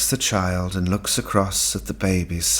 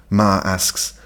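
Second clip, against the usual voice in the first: ordinary delivery, nothing marked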